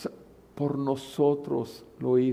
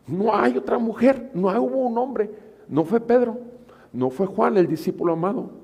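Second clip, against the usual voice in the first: higher, loud proclaiming; preaching at full force; 8 semitones higher, 4 dB louder than usual. Acted. Preacher stressing Mary was first, loud fervent preaching. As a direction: preach it to the back row.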